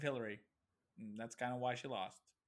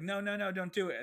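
mock pleading; 7 semitones higher, 5 dB louder than usual. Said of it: "Michael was like".

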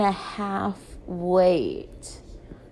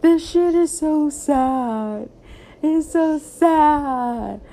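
slow, higher, teary whine; a hammed-up sad wail; mock sobbing; 6 semitones higher, slower than usual. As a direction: drag it out like you're bawling. Crying while quoting self.

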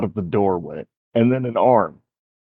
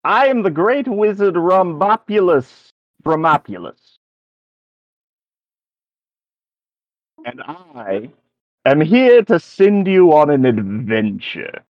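put-on higher, self-important old wizard; pompous wizard; 7 semitones higher, 3 dB louder than usual. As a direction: announce yourself like a grand wizard. DM voices the wizard.

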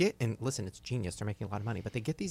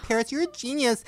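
fawning fan; 12 semitones higher, 5 dB louder than usual. Retold or imitated. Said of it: "and they're like".

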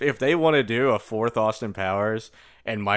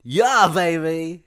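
Austin Powers voice; 3 semitones higher, 4 dB louder, much slower than usual. Retold or imitated.

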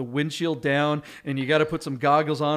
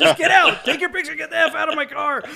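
much higher, louder, laughing mock shout; laughing mock yell; 13 semitones higher, 5 dB louder than usual. Owner chasing German tourists out of his car, shouting through laughter. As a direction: yell them out of the car while cracking up.

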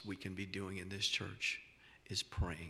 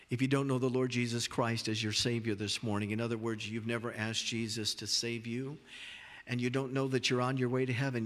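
louder, earnest altar call voice; preaching at full force; 5 dB louder than usual. Real, not acted. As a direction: press the call hard and loud to the listeners.

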